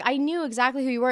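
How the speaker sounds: usual speaking voice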